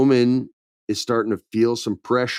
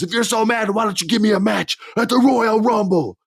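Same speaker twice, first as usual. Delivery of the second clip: much higher, taunting demand; mock challenge; 10 semitones higher than usual. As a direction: dare him in a hammed-up high voice. Owens demanding a match.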